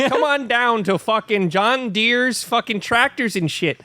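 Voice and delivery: high-pitched hammy local ad voice; Minnesota ad guy. Retold or imitated. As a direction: overdo a small-town tractor commercial. Mock Minnesota tractor ad.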